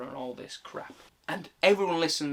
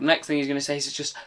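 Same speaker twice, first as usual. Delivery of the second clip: ordinary delivery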